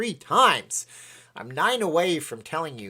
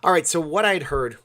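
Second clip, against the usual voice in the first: ordinary delivery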